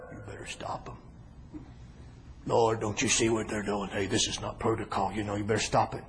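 low, hushed scolding voice; quiet, low reproach; disapproving Pharisee. Acted. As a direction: act a stuffy objector warning under the breath.

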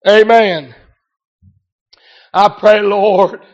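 normal talking voice

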